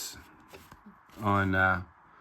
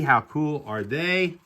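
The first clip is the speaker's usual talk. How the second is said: ordinary talk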